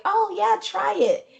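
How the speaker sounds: high, sing-song urging; high mock coaxing voice. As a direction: coax it in a high voice. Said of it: "they're like".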